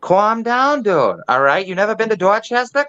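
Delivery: Boston accent. Shown as a demonstration. Doing his Boston accent for the hosts. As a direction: play a brash Boston local. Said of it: "Oh, Boston now".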